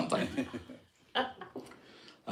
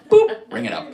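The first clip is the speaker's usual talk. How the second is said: mock scan beep; 6 dB louder than usual. Mock scanner sound.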